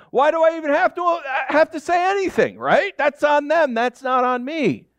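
high, indignant whine; defensive listener. Retold or imitated. Pastor voicing a congregant who won't explain, mock indignant. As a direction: mimic a listener refusing blame. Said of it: "some of you are probably here like".

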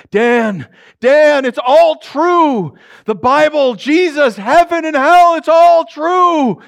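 loud, high, drawn-out wailing; haunting moan with stretched vowels; moaning ghost. Acted. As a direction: wail slowly like a tormented spirit. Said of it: "Tony starts moaning".

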